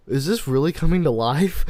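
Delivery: high, loud disbelief tipping into laughter; loud incredulous retelling, about to crack up; mock alarm. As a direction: blurt it out in comic panic. Retold panic, breaking into laughter.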